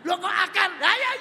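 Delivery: high, loud puzzled outburst; surprised shout. Acted. Friends shocked by her answer, high and loud. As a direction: blurt it out in disbelief.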